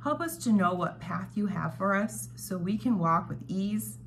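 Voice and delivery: ordinary talk, nothing marked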